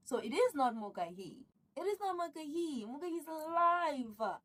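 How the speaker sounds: loud, high, thrilled insistence; she's alive, say it with a thrill; excited. Real, not acted. Excited plot reveal, loud.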